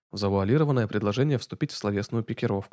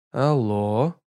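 drawn-out hello; 4 dB louder than usual. A narrator's reading. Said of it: "Протяжно, размеренно протянул".

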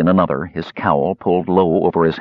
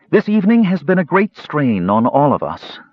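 higher, raised voice to a crowd; Shabbos addressing everyone; 7 semitones higher than usual. Acted. Counselor to everyone in the park, raised and higher. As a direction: speak up to the whole park.

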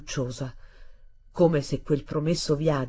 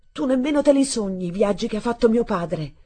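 raised, higher-pitched indignant voice; crying out; 5 semitones higher, 4 dB louder than usual. A narrator's reading. Boy defending his father's travels, crying out. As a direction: protest hotly, short of a full shout. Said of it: "io gridai".

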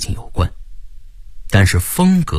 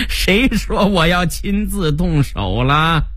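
high, drawn-out villain's taunt; gloating gang boss; 8 semitones higher, slower than usual. Acted. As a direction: gloat slowly after a long laugh. Narrator voices gang boss. (老大得意地拖长声调)

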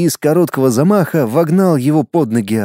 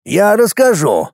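put-on old shaman; 6 semitones higher, slower than usual. Шаман внезапно за спиной.

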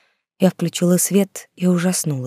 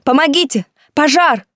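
screaming for help; 9 semitones higher, 5 dB louder than usual. A narrator's reading. Trapped in fire calling.

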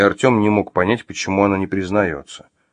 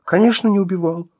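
high, hasty appeasing agreement; eager agreement; 12 semitones higher than usual. Acted. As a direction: agree readily to humour a madman. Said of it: "с готовностью закивала она".

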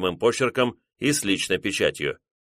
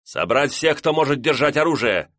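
raised, higher command to staff; shouting orders over battle; 6 semitones higher than usual. Acted. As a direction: give the order sharply, voice up. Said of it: "отдал команду Куроки".

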